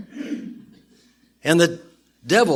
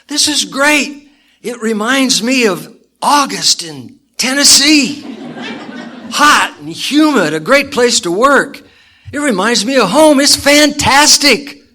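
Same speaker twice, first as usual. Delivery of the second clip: much higher and louder, beaming with delight; big happy grin; 11 semitones higher, 9 dB louder than usual. Acted. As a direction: gush like it's the best day ever. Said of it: "with a big smile".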